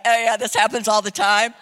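mock-casual brush-off; put-on nonchalance; playing it cool. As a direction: act as if it's routine. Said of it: "trying to play it cool".

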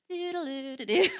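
bored mock hum, half laughing; idle humming. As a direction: hum it like killing time, chuckling. Mimics idle humming in prayer room.

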